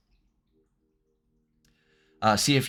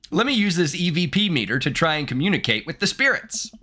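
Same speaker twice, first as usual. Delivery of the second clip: higher-pitched mock-Canadian trucker; 6 semitones higher than usual. Trucker trying to contact spirits, high Canadian accent. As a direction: put on a hammy Canadian accent. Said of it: "so sorry to anyone that's Canadian".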